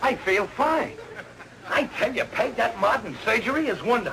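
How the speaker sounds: high, hearty enthusiasm; delighted relief. Acted. Patient to wife, suddenly feeling fine, delighted. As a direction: burst out fully recovered.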